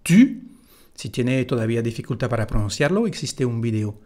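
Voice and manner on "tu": "tu" with rounded French u, said high and loud; French u sound. Shown as a demonstration. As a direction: lips rounded, "tu" not "too". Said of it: "U para pronunciar el sujeto".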